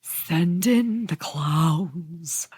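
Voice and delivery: slow eerie whisper. Acted. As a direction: whisper it slowly and eerily. Spooky whisper.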